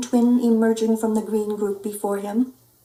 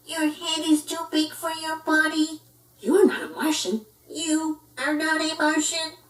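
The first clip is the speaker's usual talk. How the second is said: high odd alien voice; alien echo; 7 semitones higher than usual. Acted. Martian echoing the pup, odd higher voice. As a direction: mimic back like an alien.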